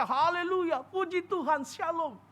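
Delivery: pious pastor voice. Retold or imitated. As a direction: parody a preacher's sweet blessings. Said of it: "jangan pikir pendeta itu cuma bisa ngomongnya". (meniru pendeta yang saleh, lembut dan sedikit tertawa)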